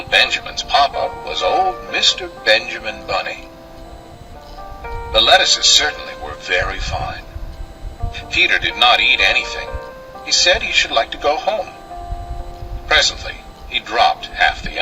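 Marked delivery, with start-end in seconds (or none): none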